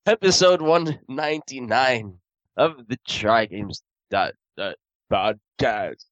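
slow announcer intro read. Acted. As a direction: announce the show slowly.

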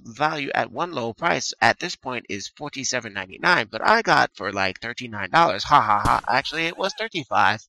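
ordinary talk

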